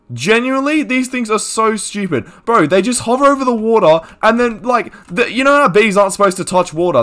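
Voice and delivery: high-pitched, worked-up ranting; exasperated rant. Real, not acted. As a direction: rant in high-pitched disbelief at sheer stupidity. Exasperated rant about bees dying.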